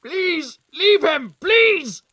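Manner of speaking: high, loud frantic begging; desperate pleading. Acted. Man begging the attackers to spare the patient, frantic plea. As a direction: beg them to let him go.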